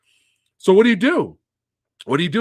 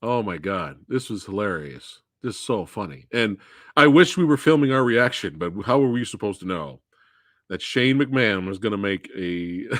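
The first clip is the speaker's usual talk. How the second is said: ordinary talk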